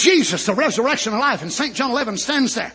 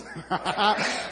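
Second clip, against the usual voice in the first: laugh; 6 dB quieter than usual. Real, not acted. Preacher delighting in the little book, brief soft laugh. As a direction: chuckle briefly between phrases.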